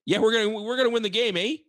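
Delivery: high-pitched mock Canadian. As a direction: put on a hammed-up Canadian "eh" accent. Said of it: "you want me to talk like, eh".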